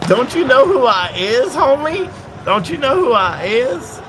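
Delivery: high, loud hammed-up swagger; mock gangster swagger. Streamer mocking a chat request, high and hammed-up swagger. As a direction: brag like a cartoon big shot.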